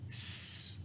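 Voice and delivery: S as a soft unvoiced hiss, no voice; whispered letter sound. Shown as a demonstration. Teacher sounds out S for the spelling word. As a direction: breathe out a quiet sss. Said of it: "What is the first sound in this word?".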